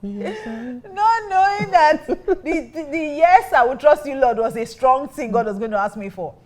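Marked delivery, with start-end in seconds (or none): 0.0-3.0 breaking into a high, slow, tearful laugh; half laughing, half crying at herself. Real, not acted. 3.2-4.4 emphatic. Real, not acted. Realising the song's meaning now.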